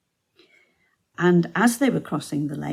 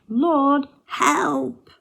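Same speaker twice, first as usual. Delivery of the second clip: high, loud plea; retold shout for help; 9 semitones higher, 6 dB louder than usual. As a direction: cry out like a terrified sailor. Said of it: "and they shout".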